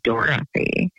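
"Dorothy" slowly in three syllables, Dor-o-thy; "Dorothy" said in full, every syllable given; proper pronunciation. Shown as a demonstration. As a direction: sound out the middle vowel in "Dorothy". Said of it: "six syllables if people pronounced my name proper".

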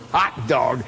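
low, slyly relishing aside; relishing anticipation. Retold or imitated. Dad in stands awaiting the boy's comeuppance, low gleeful relish.

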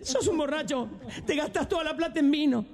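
high, scolding wife voice. Acted. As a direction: nag him in a high voice. Wife scolding drunk husband.

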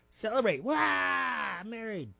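high-pitched hammed-up cheering; over-the-top high celebratory cry; mock celebration. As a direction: whoop it up in a high voice. Hammed-up cheer for being married.

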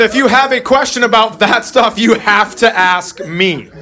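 slow, high earnest dad warning; mock-serious fatherly advice, slowed down. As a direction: spell it out slowly like a serious dad. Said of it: "I said".

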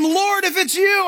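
high, slow call; lightly voiced Peter; eager Peter. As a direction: call out to Jesus on the water.

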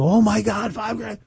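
high, stunned disbelief at the price; mock horror, voice jumping up; shocked gasp. Imitating clients reacting to a trust fee with shock. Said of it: "And people go".

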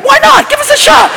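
high, thin pleading; squeaky plea. Acted. Comic pleading for oil money, squeaking high. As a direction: beg in a tiny high voice.

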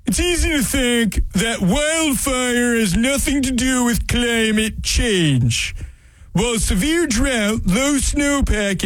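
mock solemn governor reading his post; Jay Inslee impression. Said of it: "my best Jay Inslee voice".

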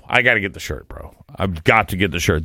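usual speaking voice

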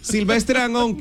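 ordinary talk

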